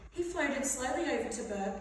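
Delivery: ordinary delivery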